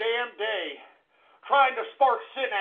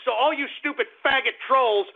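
ordinary delivery

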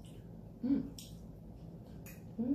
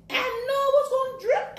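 much higher, louder, fast; high excited exclaim; 5 dB louder, faster than usual. Real, not acted. Reacting to the drip.

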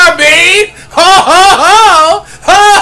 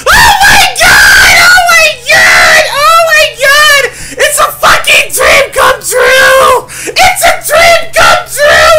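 ecstatic screaming; 11 semitones higher, 5 dB louder than usual. Real, not acted. Fanboy screaming at game heroine.

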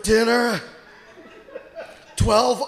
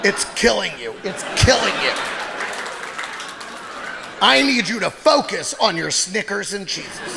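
a doctor giving orders in a lower, grave voice; 7 semitones lower than usual. Retold or imitated. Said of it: "my doctor would be like".

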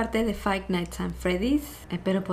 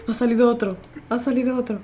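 alarmed shout; 4 semitones higher, 5 dB louder, faster than usual. Real, not acted. Another animatronic appears.

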